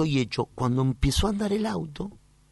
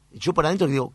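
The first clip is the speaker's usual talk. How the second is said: ordinary talk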